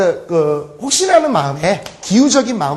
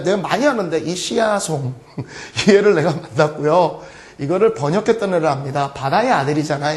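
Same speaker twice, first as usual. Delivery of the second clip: usual speaking voice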